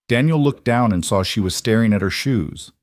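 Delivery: ordinary delivery, nothing marked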